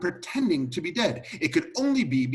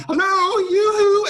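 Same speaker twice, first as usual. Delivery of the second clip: high, loud singsong holler; calling out; 10 dB louder, slower than usual. Retold or imitated. Butler re-enacting calls through the door, hollering. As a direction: shout as if through a locked door.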